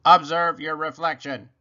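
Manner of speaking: loud, even robot instruction. A narrator's reading. Robot points Rabbit to the mirror, loud robot instruction. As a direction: order it evenly, like a machine. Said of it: "said Robot".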